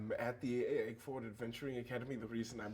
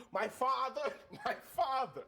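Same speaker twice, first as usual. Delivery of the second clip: higher still and louder, hit father; building drama; 13 semitones higher, 5 dB louder than usual. Acted. Player repeating his answer to the party, louder drama. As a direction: push the repeat harder, half laughing.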